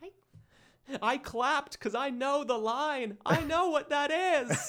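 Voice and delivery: high, slow, smug geek voice; smug nerd voice. Acted. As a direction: brag slowly like a know-it-all fan. Nerdy fan boast, high voice.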